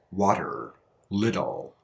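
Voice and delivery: "water, little" with each T as a soft D; flap T like D. Shown as a demonstration. Teacher showing the D-like flap T in "water, little". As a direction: lightly tap the T in "water, little". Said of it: "sounds more like a D sound".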